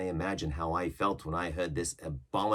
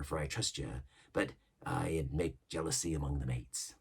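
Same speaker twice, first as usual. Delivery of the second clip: lightly voiced old pirate, a touch higher and louder; Long John Silver; 7 semitones higher, 7 dB louder than usual. Silver yarning to the crew about old ships, mild pirate voice. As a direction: spin the yarn like an old sea cook. Silver explains his money.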